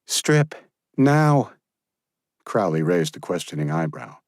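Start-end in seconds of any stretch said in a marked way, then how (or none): 0.1-1.5 higher, firm female voice; voicing Josephine. Josephine ordering Crowley to undress, commanding voice. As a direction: order him to strip, pitched up.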